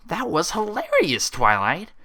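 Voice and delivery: high, slow, delighted pony voice; high giggly pony voice; gleeful Pinkie. A narrator's reading. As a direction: savour the joke with glee. Pinkie laughing at Twilight.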